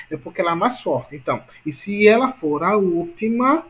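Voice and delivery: usual speaking voice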